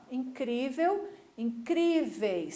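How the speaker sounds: "incrível, incríveis" very slow, leaning on CRÍ; stress on accented syllable. Shown as a demonstration. Teacher contrasting accents for pupils, "incrível, incríveis" slowly stressed on CRÍ. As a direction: stretch out the CRÍ in "incrível, incríveis", ending unstressed. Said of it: "Aqui tem acento que não tem".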